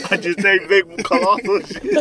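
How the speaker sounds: laughing hard and high through the words; words squeezed out between bursts of laughter. Real, not acted. Admits taking huge shits.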